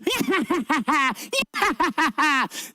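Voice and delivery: high evil witch cackle. Acted. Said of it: "They started to hear somebody laughing evilly".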